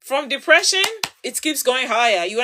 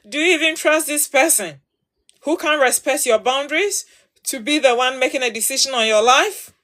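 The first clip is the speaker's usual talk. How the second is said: ordinary talk, nothing marked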